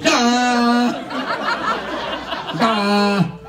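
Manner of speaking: high, loud, held cry; weird turned-on noise. Shown as a demonstration. Comedian shows noise made when turned on, weird loud cry. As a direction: let out an absurd drawn-out bleat. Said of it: "I make this real".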